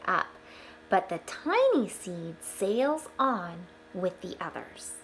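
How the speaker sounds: bright storybook read-aloud; gentle storyteller voice; reading to children. Acted. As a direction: read it out like a picture book for the class. Reading picture book aloud to children.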